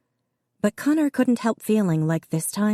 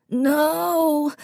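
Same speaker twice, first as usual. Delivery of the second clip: put-on much higher scream of a small boy; child's scream; 11 semitones higher than usual. A narrator's reading. Davy screaming at the officers, very high. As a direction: cry out against losing the dog. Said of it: "Davy screamed".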